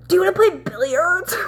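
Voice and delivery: high, exaggerated flirting imitation; mock her flirting, high and showy; mock flirty. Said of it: "She's, like, being all flirty and shit".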